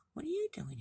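high-pitched storybook question; curious little frog. Acted. Frog greets baby deer in story.

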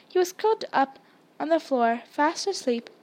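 ordinary delivery, nothing marked

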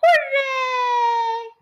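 high, loud happy cheer; shouted hooray. A narrator's reading. Crowd cheering that the Twits are gone, happy shout. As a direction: cheer with joy. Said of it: "everyone shouted".

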